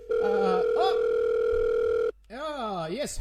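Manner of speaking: ordinary talk, nothing marked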